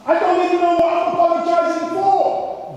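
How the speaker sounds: high mock shout; exasperated protest. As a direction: wail it like a hard-done-by complainer. Acted protest to the Lord.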